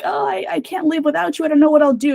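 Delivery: high, hammed-up lovesick whine; mock desperate lover. Mocking a lovesick duke who can't live without her, pitched high. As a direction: play a clingy hero begging her not to leave.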